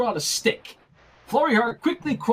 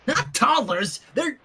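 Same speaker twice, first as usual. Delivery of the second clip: panicked protest; 9 semitones higher than usual. Acted.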